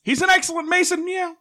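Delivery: high put-on voice ending in a meow; a squeaky cartoon reply; silly voice. Player answers the policeman in a high squeaky cat voice.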